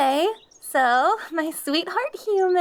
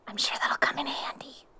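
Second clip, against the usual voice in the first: soft sneaky aside; whispering; 8 dB quieter than usual. Acted. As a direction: whisper it behind her back. Sly aside to the listener.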